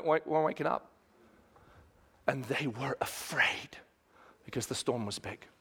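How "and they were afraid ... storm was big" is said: hushed. Acted. Hushed storytelling of fear.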